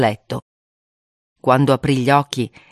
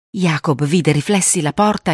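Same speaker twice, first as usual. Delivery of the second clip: ordinary delivery, nothing marked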